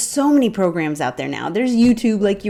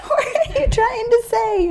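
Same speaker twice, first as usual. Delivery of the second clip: confused Welsh locals. Retold or imitated. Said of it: "And they were like".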